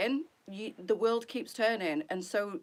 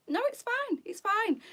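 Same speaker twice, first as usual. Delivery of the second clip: kind stranger's voice; 12 semitones higher than usual. Retold or imitated. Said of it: "she's like".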